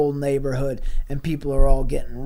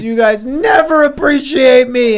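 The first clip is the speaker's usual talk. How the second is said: higher, louder wounded complaint; hurt outburst; 8 semitones higher, 9 dB louder than usual. Acted. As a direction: wail it like an unappreciated monster. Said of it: "Which means".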